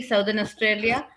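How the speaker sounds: ordinary delivery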